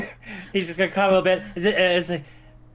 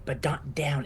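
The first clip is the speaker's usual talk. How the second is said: soft quick whispered aside; hushed afterthought; 6 semitones lower, 7 dB quieter than usual. Acted. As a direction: whisper the correction. Aside correcting himself.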